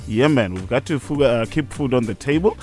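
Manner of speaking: ordinary delivery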